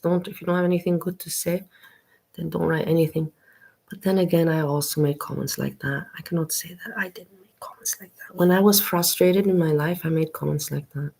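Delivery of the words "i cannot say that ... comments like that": hushed, breathy admission; whispered confession. Real, not acted. Admitting to viewers she was mean once, whispered. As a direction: whisper it like a guilty secret. Admitting her own past meanness.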